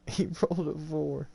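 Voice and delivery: laughing through the words, high and loud. Real, not acted. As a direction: crack up while you say it. Laughing at a bad roll.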